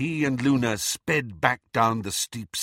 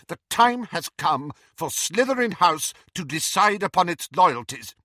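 higher, louder, commanding; firm interruption; 6 semitones higher, 5 dB louder than usual. A narrator's reading. Professor interrupts Slughorn about his house's loyalties, firm. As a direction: cut in with authority. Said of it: "interrupted Professor McGonagall".

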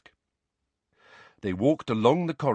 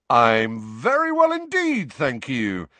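higher, louder, slow exuberant boast; 3 semitones higher, 5 dB louder, slower than usual. A narrator's reading. Vain teacher answering students, exuberant. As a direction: beam it out grandly. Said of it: "said Lockhart exuberantly".

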